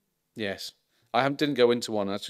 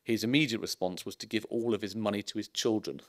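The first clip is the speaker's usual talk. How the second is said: ordinary talk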